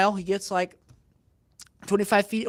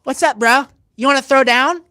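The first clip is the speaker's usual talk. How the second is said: louder, higher imitation of a man picking a fight; mock challenge; 4 semitones higher, 8 dB louder than usual. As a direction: square up with a put-on dare.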